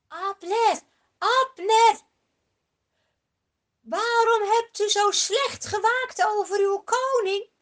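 loud high shout across the valley; David shouting to the distant camp; calling out. Acted. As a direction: call to Abner from the far hill. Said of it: "dan roept David".

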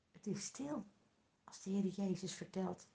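hushed. Acted. Storyteller tells children the synagogue is silent, hushed and soft.

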